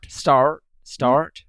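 "Start" with a deliberate hard R; attempted American R. Shown as a demonstration. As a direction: practise "Start" in an American accent. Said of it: "Say start".

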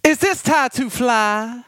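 high slow put-on voice; high squeaky prank caller. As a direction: ask it in a high, slow, fake voice. Prank call voice greeting.

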